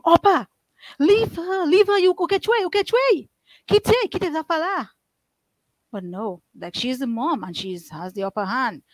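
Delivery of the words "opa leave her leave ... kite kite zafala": high, pleading yell to stop the beating; neighbors screaming. Retold or imitated. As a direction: shout it out to make her stop. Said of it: "the neighbors would scream".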